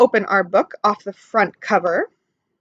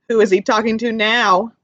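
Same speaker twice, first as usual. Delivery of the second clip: ordinary delivery, nothing marked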